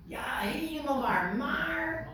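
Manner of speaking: mock objection rising high on maar; lightly hammed-up "ja, helemaal waar. Maar"; playing a reluctant listener; imitating churchgoers. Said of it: "de mensen die ik dus vaak krijg".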